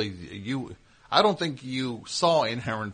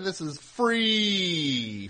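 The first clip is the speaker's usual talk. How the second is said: "free" stretched very long, higher, gliding down; stress on free; 6 semitones higher, much slower than usual. Real, not acted. Host assuring listeners there's no paywall, "free" drawn out. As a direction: draw out "free" in one long falling note.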